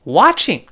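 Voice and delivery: Watching hit high and loud; Watching stressed, falling. Shown as a demonstration. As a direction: punch the word Watching. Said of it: "这重读了".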